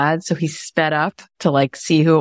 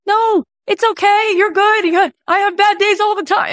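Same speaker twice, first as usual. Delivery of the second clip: mock reassuring. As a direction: comfort the stranger, hammed up. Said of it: "And my friend goes".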